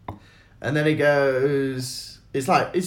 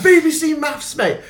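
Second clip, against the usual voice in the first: mocking heckler; 13 semitones higher, 5 dB louder than usual. Parroting the heckler's catchphrase.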